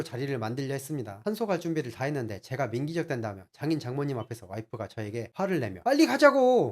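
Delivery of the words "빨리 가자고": much higher, much louder shout; angry yell. Retold or imitated. Wife screaming at husband to hurry up. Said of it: "소리를 질렀습니다".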